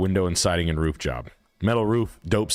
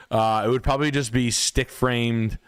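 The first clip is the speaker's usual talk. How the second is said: usual speaking voice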